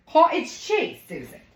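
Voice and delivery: loud, high-pitched mock-British exclamation; British accent. Cook correcting an imaginary Susan, hammed-up British accent. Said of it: "I just broke into a British accent".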